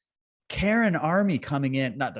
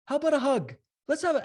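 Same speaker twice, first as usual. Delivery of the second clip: much higher, sweet, coaxing; friendly invite; 13 semitones higher than usual. Retold or imitated. Inviting counter-protesters to a hug, much higher and sweet. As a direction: coax like a cheerful peacemaker. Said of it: "I'm like".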